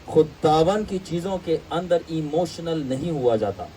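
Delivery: usual speaking voice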